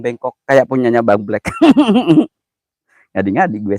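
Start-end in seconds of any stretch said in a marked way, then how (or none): 1.5-2.3 high squealing laugh; shrill giggle. Real, not acted. Laughs at own joke.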